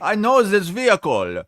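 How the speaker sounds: high, loud mock Serbian accent; Serbian-sounding accent. Replaying the rental guy's defense, in a mock Serbian accent. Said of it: "That's Serbians rubbing off on us".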